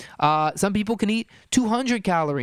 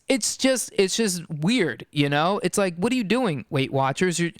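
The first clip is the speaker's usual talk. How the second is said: normal talking voice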